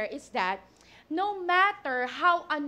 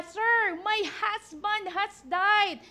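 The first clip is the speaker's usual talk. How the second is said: slow, raised, heartfelt plea; heartbroken widow; 4 semitones higher, much slower than usual. Shown as a demonstration. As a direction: cry out a bereavement with conviction, drawn out. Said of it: "it sounds like the widow woman is".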